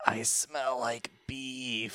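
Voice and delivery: slow, soft, drawn-out deadpan; slow Vine quote. Retold or imitated. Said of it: "speaking in Vines".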